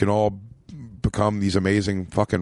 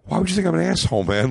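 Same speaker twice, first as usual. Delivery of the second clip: hurt puzzled question; 8 semitones higher, faster than usual. Retold or imitated. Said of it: "I'm just kinda like".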